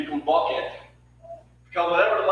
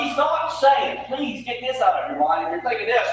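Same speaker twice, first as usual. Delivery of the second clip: ordinary talk, nothing marked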